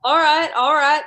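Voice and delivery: loud, firm call to settle down; calling for order. Acted. Narrator breaking up the heckling.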